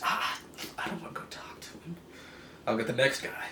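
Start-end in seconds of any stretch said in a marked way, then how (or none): 0.0-2.0 higher mock-scared whisper; breathless frightened whisper; whispered refusal. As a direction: whisper it like you're afraid of being heard. Next official refusing, whispered.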